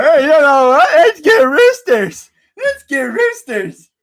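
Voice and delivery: high, slow mock cheerful dad. Mocking his dad for buying roosters, hammed-up. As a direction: parody dad's bright idea. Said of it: "My dad really thought".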